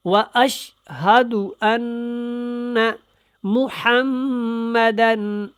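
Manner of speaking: slow recitation with humming ghunna on أَنَّ and مُحَمَّدًا; nasalized ghunna. Shown as a demonstration. Teacher demonstrating ghunna to learners, slow nasal recitation. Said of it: "غنہ کرنا ہے".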